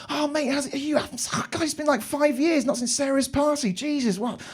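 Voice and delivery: put-on overjoyed to see an old mate; gushing catch-up greeting. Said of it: "I'm like".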